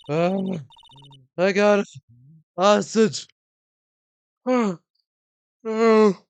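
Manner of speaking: panting crone, winded from the chase; huffing old woman. Acted. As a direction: gasp it out like a winded old lady. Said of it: "that huffing and puffing".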